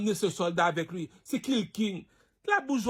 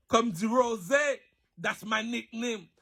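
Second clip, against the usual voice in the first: normal talking voice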